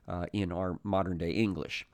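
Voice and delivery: ordinary talk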